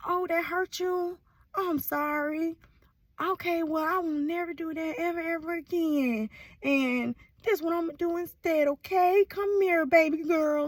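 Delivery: acted sweet, babying reassurance; tender apology. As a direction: comfort a hurt sweetheart, cooing. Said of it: "and they be like".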